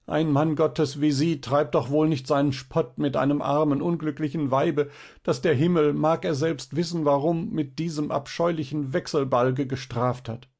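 high-pitched tearful lament of a wretched mother; weinerliche Stimme. A narrator's reading. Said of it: "mit weinerlicher Stimme". (mit hoher, weinerlicher Stimme klagend)

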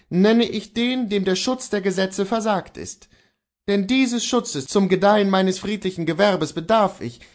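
a defiant man holds his ground; Kohlhaas voice. Acted.

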